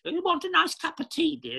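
high-pitched put-on English voice; high mock-English "dear" voice; English tea-offering voice. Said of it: "you know, in English".

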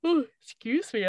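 yawning through the words; yawning sigh. Real, not acted. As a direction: yawn mid-sentence. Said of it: "I'm yawning".

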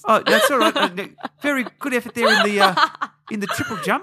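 lightly voiced polite teacher, amused; schoolteacher role. Schoolteacher complimenting the outlaw's jumping, stifling laughter. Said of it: "he's a school teacher".

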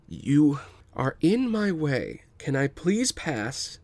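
slow and deliberate; calm slow refusal to a bully; slow, measured. Acted. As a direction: spell it out patiently. Tak calmly faces bully.

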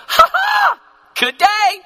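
high, loud whooping exclamation; jubilant laugh. Acted. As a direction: crow it out as a delighted believer. Voices believer's joyful response.

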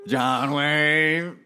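imitated prank-call voice, name drawn out; prank caller. Retelling how the prank call escalated, drawn-out silly caller voice. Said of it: "it just got".

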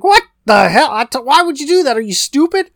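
high, exasperated retold yell; angry outburst. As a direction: snap at him like an angry boss. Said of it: "Jimmy's pissed".

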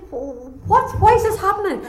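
high, loud desperate cry; crying out in panic. Retold or imitated. Terrified sailors cry out to their false gods, panicked and high. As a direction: cry out to the gods as the ship pitches. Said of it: "They're calling out to their false gods".

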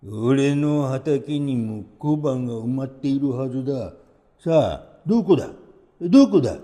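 がめつく強引な老人の声; せかせか探し回る欲張り爺さん; 欲張りじいさん. A narrator's reading. (大きくなっていく欲深い声)

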